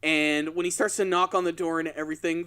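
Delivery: ordinary delivery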